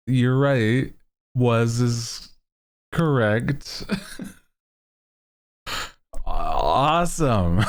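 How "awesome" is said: normal talking voice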